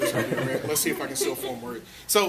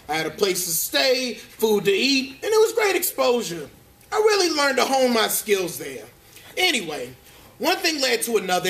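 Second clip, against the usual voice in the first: irritable cartoon bear telling his showbiz story, pitched higher; higher-pitched, put-upon storyteller; Grumpy Bear; 6 semitones higher than usual. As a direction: gripe through a jaded actor's memoir.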